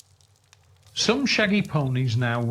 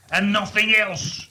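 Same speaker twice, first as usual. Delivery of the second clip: louder, higher, gloating that he is right; triumphant old miser, a touch higher and louder; 6 semitones higher, 5 dB louder than usual. Acted. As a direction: crow it out in triumph. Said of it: "said Scrooge triumphantly".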